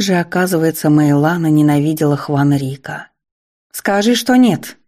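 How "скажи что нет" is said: breaking into a shout. A narrator's reading. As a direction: voice rising to a desperate shout. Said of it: "сорвалась на крик девушка".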